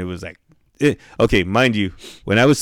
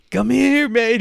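very high-pitched beckoning; stoned plea; slower than usual. Retold or imitated. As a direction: imitate a helpless stoned guy calling his friend over. Stoned, summoning friend.

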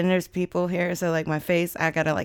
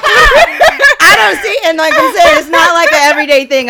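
loud, high laughing protest; cracking up, voice pitched way up; 12 semitones higher, 16 dB louder than usual. Real, not acted. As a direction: protest through real laughter. Flustered, laughing at own confession.

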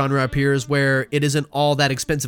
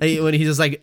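ordinary talk, nothing marked

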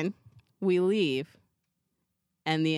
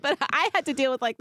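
ordinary delivery

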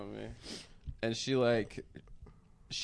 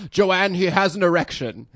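raised, louder mock-dad call; alarmed dad; 6 semitones higher, 9 dB louder than usual. Dad calling mom over, mock-alarmed shout. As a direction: summon the wife urgently, imitating dad. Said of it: "he goes to my mom".

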